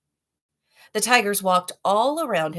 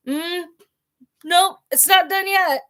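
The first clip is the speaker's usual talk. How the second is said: fussy tiger; 12 semitones higher, 6 dB louder than usual. Acted. Tiger checks another's mouth.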